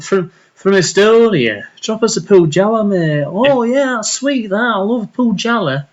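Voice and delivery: high, swooping Yorkshire voice; mock Yorkshire accent. Praising Pujala to a mate, mock Yorkshire accent. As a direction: play the ale lover in put-on Yorkshire. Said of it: "You keep saying Yorkshire accent".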